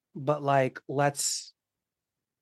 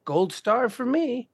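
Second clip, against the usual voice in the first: smug self-praise; 7 semitones higher, slower than usual. Retold or imitated. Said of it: "And you were like".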